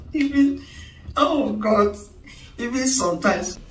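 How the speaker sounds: loud, high exclamation; excited outburst. Acted. Wife exclaims loudly to her friend, exasperated. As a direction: burst out in exasperation. Exclaiming about husbands' apologies.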